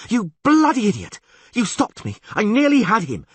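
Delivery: high, loud furious scream; furious yell. A narrator's reading. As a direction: rage at him. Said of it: "Yennefer yelled".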